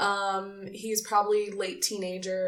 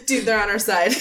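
louder, laughing through it; laughing repeat; 6 dB louder, faster than usual. Real, not acted. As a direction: crack up while repeating the line. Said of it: "repeats own line laughing".

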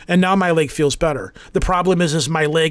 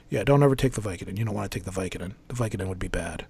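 low, soft imitated doctor; retold warning, dropping much lower and softer; quiet doctor warning; 7 semitones lower, 8 dB quieter, faster than usual. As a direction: sink into a low, hushed caution.

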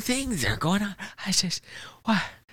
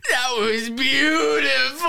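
choked up; 8 semitones higher, 4 dB louder, slower than usual. Acted. Overwhelmed by praise, being comforted.

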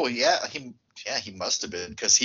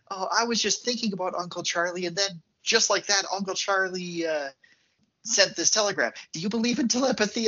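higher-pitched, breezy retold explanation; eager explaining girl, imitated; 6 semitones higher than usual. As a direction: gush lightly like a girl sharing her theory. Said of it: "It's like she's explaining".

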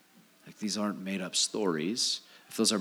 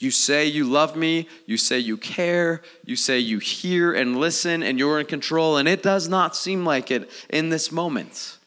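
raised, louder pleading complaint; aggrieved believer challenging God; exasperated plea; 5 semitones higher, 5 dB louder than usual. Acted. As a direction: protest to Jesus, building in pitch and volume. Voicing believer's complaint to Jesus.

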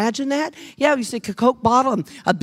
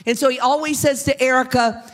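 normal talking voice

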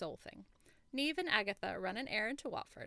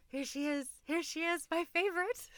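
rising higher and louder with delight; excited fangirl; 7 semitones higher, 4 dB louder than usual. Retold or imitated. Reader greeting a favourite character, gleefully rising. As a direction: greet her like a beloved star.

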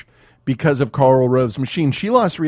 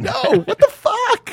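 much higher, incredulous; high mock disbelief.

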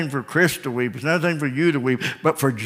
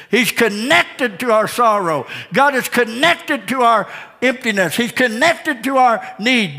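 rising preacher's cadence, higher line by line; building sermon; 5 semitones higher than usual. Acted. Pastor assuring the church God is connected, building preaching. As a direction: build the refrain toward a climax.

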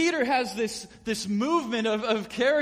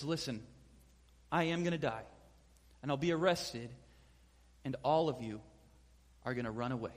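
low, soft, grave warning; hushed somber prediction; somber quiet; 8 semitones lower, 6 dB quieter than usual. Retold or imitated. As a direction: speak it like a last goodbye. Jesus foretells death at supper.

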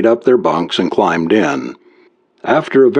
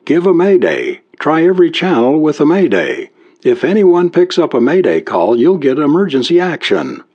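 higher-pitched, urging with a new idea; sudden inspiration; 7 semitones higher than usual. A narrator's reading. Carol urges Bill to send a distress call, suddenly inspired. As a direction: lift the pitch, mildly excited. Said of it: "Carol said in sudden inspiration".

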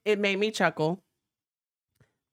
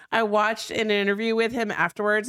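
ordinary delivery

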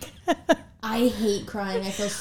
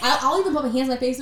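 ordinary talk

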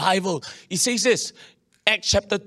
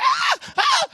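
very high mock shrieks; hammed-up high-pitched screams. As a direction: squeal like the wife seeing a lizard. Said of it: "she go like".

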